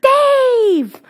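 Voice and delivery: high, loud drawn-out call; retold yelling. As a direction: yell the name down to the street from a balcony. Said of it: "I start yelling".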